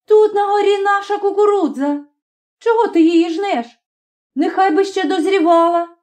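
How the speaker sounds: high, urgent protesting cry; girl's high-pitched outcry. A narrator's reading. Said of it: "скрикнула".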